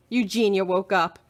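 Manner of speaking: usual speaking voice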